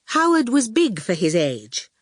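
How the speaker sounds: usual speaking voice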